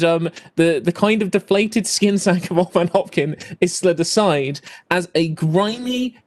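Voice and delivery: ordinary talk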